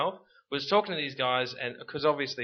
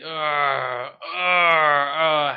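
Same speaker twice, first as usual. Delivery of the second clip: loud groaning arr; hammed-up seasick pirate groans; 9 dB louder, much slower than usual. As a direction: moan it like a queasy sailor. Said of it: "and they probably".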